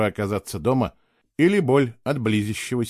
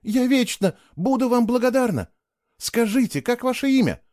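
grateful woman voice; 11 semitones higher than usual. Acted.

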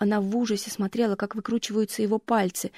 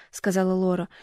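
ordinary delivery

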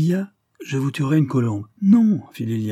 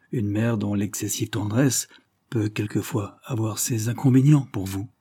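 ordinary delivery

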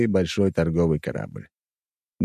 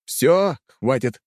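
raised, higher-pitched firm cutoff; loud declaration; 5 semitones higher, much slower than usual. A narrator's reading. Narrator cuts off Tanya's rant, declaring it aloud. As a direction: declare it firmly to end the argument. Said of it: "громко заявил я".

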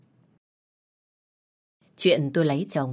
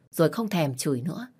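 usual speaking voice

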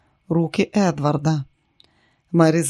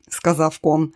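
plain reading voice

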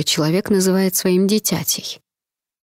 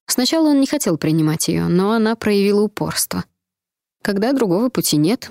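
ordinary delivery, nothing marked

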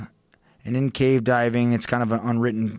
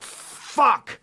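sudden high, alarmed cry of fuck; retold panicked curse; 12 semitones higher than usual. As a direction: blurt it out in a spike of alarm. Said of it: "And I'm like".

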